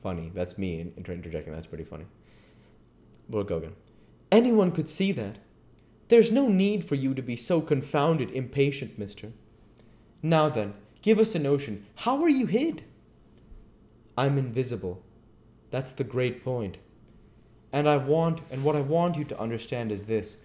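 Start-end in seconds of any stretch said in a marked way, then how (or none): 4.3-12.8 high, loud, irritated tramp voice; impatient tramp. Acted. Tramp challenges the invisible man, loud and impatient. 14.2-20.4 raised, emphatic voice of the unseen man; insistent invisible man. Acted. Invisible man explains himself to the tramp, insistent.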